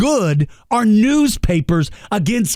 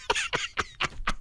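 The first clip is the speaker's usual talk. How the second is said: breathy laugh; 14 dB quieter than usual. Real, not acted. Laughs at own rant.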